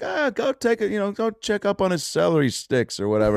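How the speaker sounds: imitated friend's wisecrack; Harlan teasing. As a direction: tease him into going over.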